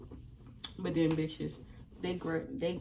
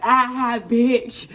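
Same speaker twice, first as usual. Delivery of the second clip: mock gloating taunt; 5 semitones higher, 10 dB louder, much slower than usual. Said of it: "I was just real disrespectful".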